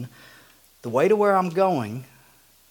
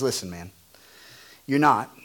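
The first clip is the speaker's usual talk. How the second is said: gentle, lower Jesus; 6 semitones lower, 6 dB quieter, faster than usual. Acted. Jesus quietly telling Peter he will deny him. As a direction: lower and softer, calm correction. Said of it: "And Jesus says".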